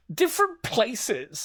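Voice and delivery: high, drawn-out evasive reply; acted film line, high and very slow; Showgirls character. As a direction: stretch it out slowly, way up high. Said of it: "She's like".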